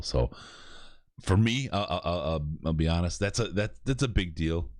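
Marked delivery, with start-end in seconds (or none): none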